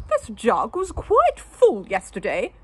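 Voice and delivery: high, loud genteel lady voice; fussy wife, voiced high and loud; prim wife. A narrator's reading. Wife chiding her husband, high and loud. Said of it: "she said to her husband".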